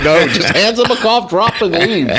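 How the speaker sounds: talking through a laugh; amused, laughing. Real, not acted. Laughing at a colleague's joke.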